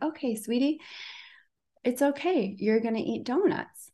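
tender self-talk calming down the panic; soothing self-talk. Retold or imitated. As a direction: comfort yourself like a child. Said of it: "And was like".

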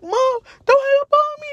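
high, tearful, begging mom; crying plea. Retold or imitated. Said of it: "I'm still crying".

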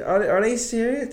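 high, disbelieving question; incredulous. Retold or imitated. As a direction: push it up in disbelief. Disbelief at accusation.

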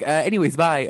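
lightly acted flustered goodbye; mock dismissive. Retold mother dismissing her child.

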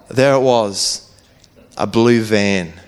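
slow, low storyteller's reveal; dramatic reveal. Real, not acted. Storyteller revealing the feared kidnapping vehicle, slow dramatic reveal.

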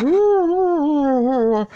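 high, loud imitation of distant hollering; mock yelling. Imitating strangers yelling outside the car, high mock whoops. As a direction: imitate someone whooping in the rain.

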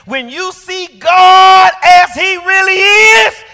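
high, slow, full-throated shout; ringing sermon peak; preaching shout. Real, not acted. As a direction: hit the climax of the sermon. Sermon climax.

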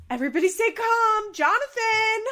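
high, loud, near-crying plea; frantic Susie. Retold or imitated. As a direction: squeal it like a panicked mom. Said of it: "Susie's like".

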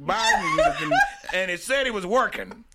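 high, rambling grandpa impression; old granddad voice. Granddad quoted on phone.

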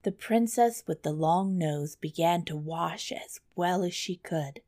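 plain reading voice

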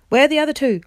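high, loud urgent question; shout. A narrator's reading. King to Eustace mid-fight, shouting. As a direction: shout it across to a comrade. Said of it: "shouted to Eustace".

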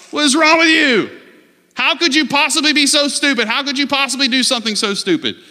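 high-pitched mock road-rage tirade; frustrated yelling. Driver to someone who cut him off, frustrated yelling. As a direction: berate the other driver in loud disbelief. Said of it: "we yell, we get frustrated".

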